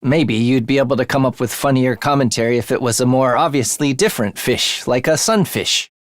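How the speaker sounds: ordinary delivery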